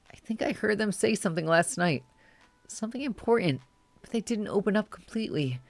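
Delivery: put-on a nervous teen calling mom, holding back tears; anxious young caller. Caller voice continues.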